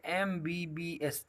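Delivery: एमबीबीएस spelled out slowly, each letter apart; slow demo for class. Shown as a demonstration. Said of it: "जब सब इनको अलग अलग बोलते हैं".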